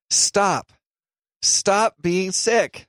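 slow, teasing, emphatic order; mock stern command. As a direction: order him to stop, playfully. Jokingly orders sick cohost.

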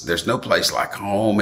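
ordinary delivery

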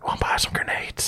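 sly whispered sales pitch; whispering. Acted. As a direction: whisper it like a shady dealer. NPC sneaks in a sales pitch.

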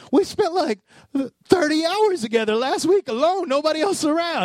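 high, indignant protest; exasperated protest. Pretend. Partner protesting the time already spent together, indignant. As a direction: whine it like an offended partner. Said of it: "And we're like".

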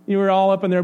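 ordinary talk, nothing marked

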